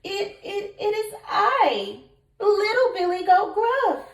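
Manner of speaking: high, stammering small goat voice; stammering little goat. Acted. Little goat stammering nervously in reply to the troll. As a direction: stutter it out, scared of the troll. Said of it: "the goat replied".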